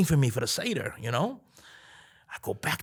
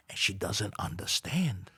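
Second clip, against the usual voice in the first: normal talking voice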